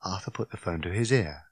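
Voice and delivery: plain reading voice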